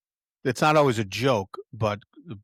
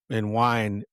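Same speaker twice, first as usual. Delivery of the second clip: ordinary talk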